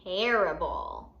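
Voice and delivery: usual speaking voice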